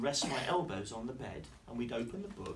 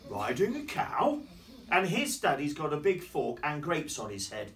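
astonished story character; 3 semitones higher, 7 dB louder than usual. Acted. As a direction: react with big storybook amazement.

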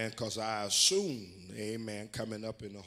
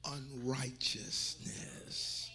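very slow, whispery drawn-out word; hushed pulpit emphasis; dramatic hush; 3 semitones higher, 4 dB quieter, much slower than usual. Real, not acted. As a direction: drop to a stretched, airy whisper. Preacher drops voice for effect.

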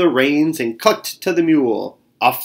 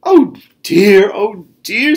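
worried fretting; 8 semitones higher, 6 dB louder, slower than usual. Acted. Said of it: "said Bear Louise".

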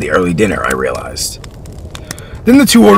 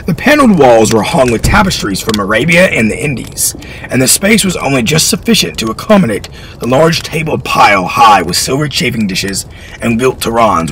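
ordinary delivery

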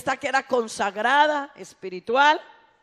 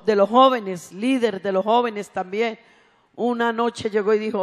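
ordinary talk

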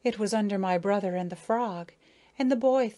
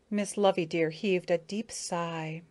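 usual speaking voice

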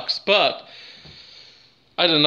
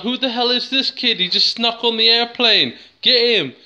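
higher-pitched, imitated outraged onlookers; mock alarm; 8 semitones higher, 4 dB louder than usual. Onlookers spotting a stowaway kid on the airplane, mock alarm. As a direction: play a crowd raising the alarm. Retold alarmed airport crowd.

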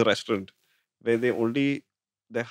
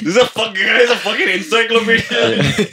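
laughing; 12 semitones higher, 4 dB louder than usual. Real, not acted. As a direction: crack up while saying it. Laughing at friend's knowledge.

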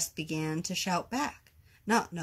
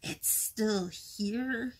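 drawn-out storybook exclamation of fury; slow, mock-furious cry; 3 semitones higher, much slower than usual. A narrator's reading. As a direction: stretch it out slowly in outraged disbelief. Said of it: "he cried".

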